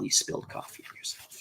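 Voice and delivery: a soft whispered aside, almost voiceless; whispered tease. Real, not acted. Teasing Al about the spilled coffee, whispered. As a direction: breathe it like a secret.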